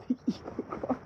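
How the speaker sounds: high, breaking into laughter; helpless giggle over the words; laughing. Real, not acted. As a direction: laugh through the words, voice jumping up. Laughs while showing the car.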